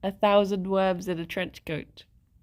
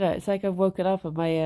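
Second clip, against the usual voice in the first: normal talking voice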